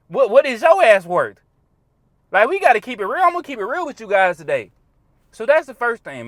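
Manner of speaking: normal talking voice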